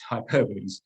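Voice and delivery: hyperboles as hy-PER-buh-lees; hyperboles ending in "lees", stress on the second syllable; correct pronunciation. Shown as a demonstration. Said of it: "as it's pronounced".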